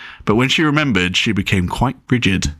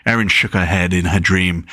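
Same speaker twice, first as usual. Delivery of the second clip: plain reading voice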